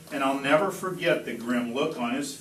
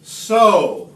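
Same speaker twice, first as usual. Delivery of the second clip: loud call, higher; 6 semitones higher, 8 dB louder than usual. Acted. Once-ler tossing the seed to the boy, calling out loud. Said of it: "calls the Once-ler".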